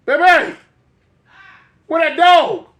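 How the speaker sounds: high, loud mock call; high loud playful holler. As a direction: holler out like a showman.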